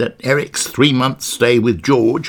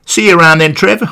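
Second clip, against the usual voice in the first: lively, higher Essex lad voice; chirpy Essex geezer greeting a mate; Essex accent; 6 semitones higher, 8 dB louder, faster than usual. Retold or imitated. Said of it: "with an Essex accent".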